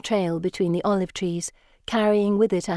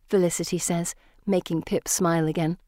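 ordinary delivery

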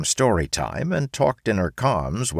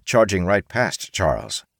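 usual speaking voice

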